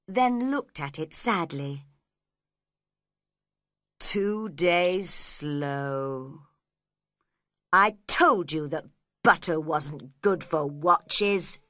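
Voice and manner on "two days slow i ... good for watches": slow, grumbling Hatter complaint; a lightly voiced annoyed Hatter; angry. A narrator's reading. Hatter scolds the March Hare over butter, annoyed. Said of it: "he said angrily".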